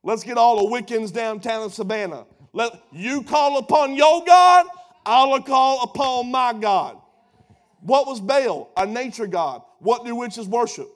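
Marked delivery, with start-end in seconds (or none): 2.5-7.0 high, loud, driving preacher cadence; fiery preaching. Acted. Preacher as Elijah daring Baal's prophets, loud and high. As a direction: throw down the contest like a dare.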